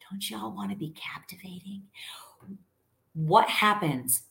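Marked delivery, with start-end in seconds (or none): 0.1-1.9 breathy whispered aside to the audience. Acted. Coach whispering a teasing question to her listeners. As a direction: lean in and whisper it like a secret.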